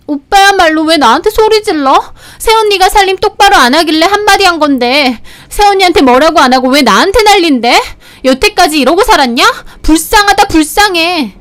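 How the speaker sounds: high-pitched indignant sister arguing back; shrill, ringing argument. Acted. Sister turning on her brother for yelling, shrill and indignant. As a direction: argue back in a high, piercing voice. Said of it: "신우는 카랑카랑하게 따져대고".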